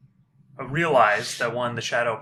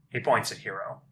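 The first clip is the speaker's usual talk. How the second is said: normal talking voice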